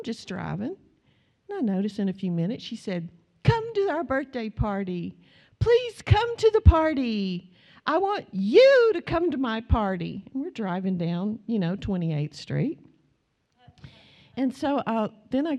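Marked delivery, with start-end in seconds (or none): 3.4-10.2 put-on high, loud little girl calling out the car window, pleading; child calling out. Granddaughter calls to passing cars, high and loud pleading. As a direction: shout it like a small girl begging everyone to come to her party.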